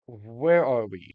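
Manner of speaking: slow, drawn-out where are we, in a dramatic voice; slow dramatic question. Shown as a demonstration. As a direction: draw out where are we slowly for suspense. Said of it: "First of all".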